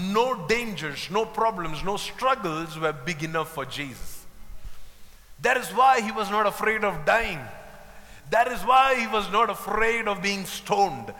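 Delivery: ordinary talk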